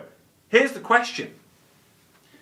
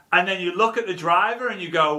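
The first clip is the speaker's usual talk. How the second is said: normal talking voice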